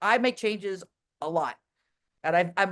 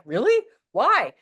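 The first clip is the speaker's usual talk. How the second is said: high, louder surprised disbelief; surprised question; 5 semitones higher, 6 dB louder, slower than usual. Retold or imitated. Asking Levi why he isn't afraid, high surprised. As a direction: ask it like you can't believe it. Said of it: "I'm like".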